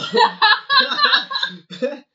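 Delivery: high, loud burst of laughter; real helpless laugh. Real, not acted. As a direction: crack up at your own story. Laughs at own plan.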